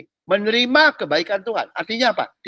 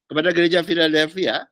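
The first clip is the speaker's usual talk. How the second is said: normal talking voice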